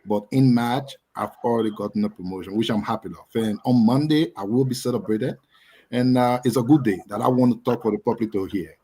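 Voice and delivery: ordinary delivery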